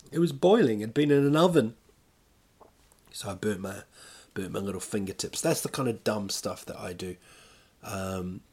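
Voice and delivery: usual speaking voice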